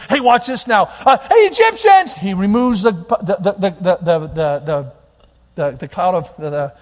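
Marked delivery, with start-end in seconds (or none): 0.1-2.1 loud call, jumping high on Egyptians; gleeful showman shouting at the enemy; teasing call. Acted. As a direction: call out to the Egyptians to tease them. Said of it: "he goes".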